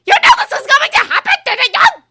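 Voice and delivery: very high, very loud screaming rage; furious scream. A narrator's reading. Umbridge screaming accusations at Ron. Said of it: "she screamed".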